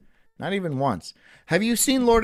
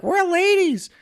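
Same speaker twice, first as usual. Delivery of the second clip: mock lady voice; 13 semitones higher than usual. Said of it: "But now they're like".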